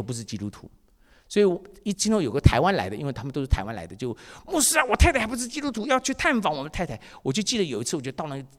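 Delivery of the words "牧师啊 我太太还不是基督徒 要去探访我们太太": 1 pleading church brother. Retold or imitated. Church brother asks pastor.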